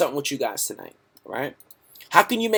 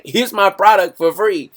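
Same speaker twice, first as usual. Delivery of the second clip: generous fan offer; 6 semitones higher, 7 dB louder than usual. Retold or imitated. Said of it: "this guy hits me up on my fan page like".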